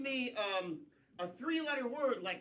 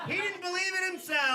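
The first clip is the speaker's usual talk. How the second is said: higher, louder announcer call; excited call; 8 semitones higher, 7 dB louder than usual. Real, not acted. Host announcing to the crowd, excited and loud. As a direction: cheer the player out to the crowd.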